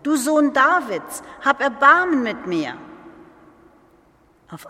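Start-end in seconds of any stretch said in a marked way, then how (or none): 0.0-2.7 high, loud desperate plea; calling louder. Retold or imitated. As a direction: gather all courage and call out. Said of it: "habe noch viel lauter gerufen".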